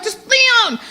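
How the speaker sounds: high, urgent no no no; frantic brush-off. Retold or imitated. To her band, frantic to keep the song. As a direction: wave the band off, mid-inspiration. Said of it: "I'm like".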